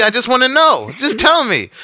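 retold high, exasperated pleading; exasperated demand. Customer pressing pizza man to tell him, exasperated demand.

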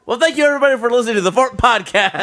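high, loud hammed-up radio host outro; announcer sign-off. Host signing off the podcast in a hammed-up announcer voice. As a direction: boom it like a cheesy show host.

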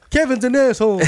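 high put-on character voice. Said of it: "when I did the voice before".

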